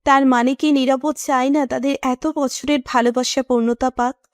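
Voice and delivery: plain reading voice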